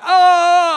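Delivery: sudden high loud yelp of pain. Retold or imitated. Pastor yelping as the muscular minister grabs his foot.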